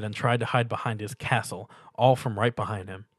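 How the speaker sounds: usual speaking voice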